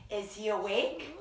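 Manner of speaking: ordinary delivery, nothing marked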